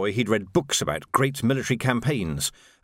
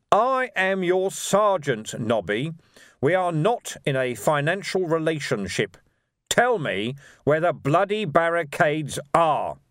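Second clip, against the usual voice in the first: raised-pitch, deliberate, exasperated orders; stern sergeant; 6 semitones higher, slower than usual. A narrator's reading. Sergeant demanding barricade news from urchin, exasperated. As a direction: spell it out slowly and firmly.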